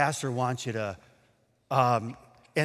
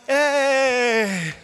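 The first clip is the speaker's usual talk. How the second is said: acted cheer; 8 semitones higher, 6 dB louder than usual. Doubter joins the cheering.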